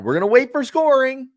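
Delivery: high nasal announcer voice; nasally announcer impression. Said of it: "very nasally voice".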